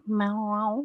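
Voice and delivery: drawn-out, playful meow; mock cat meow. Greeting viewer Zoe the cat, mock meowing.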